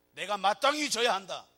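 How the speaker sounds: loud, firm vow of duty; solemn declaration. Pretend. Jesus accepting the cross, loud and resolute. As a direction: proclaim it with full resolve. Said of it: "이렇게 십자가 진 게 아니란 말이에요".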